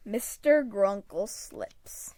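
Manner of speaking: plain reading voice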